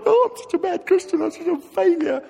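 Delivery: very high, whiny wallowing voice; mock self-pity. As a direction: wail like a hammed-up failure.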